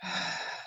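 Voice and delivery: soft voiceless breath out; deep exhalation. Shown as a demonstration. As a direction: quietly release the breath. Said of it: "deep exhalation".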